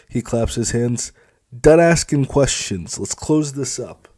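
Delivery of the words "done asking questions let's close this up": slow, loud, high dismissal; firm, drawn-out brush-off; impatient supervisor. Acted. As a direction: shut the meeting down. Felix ends it.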